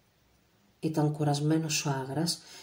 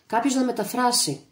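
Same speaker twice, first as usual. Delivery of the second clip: a sharp raised call for a translator; shouting; 8 semitones higher, 5 dB louder than usual. A narrator's reading. Agras shouting at his men for someone to translate. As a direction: call out loud across the hut. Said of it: "φώναξε ο Άγρας".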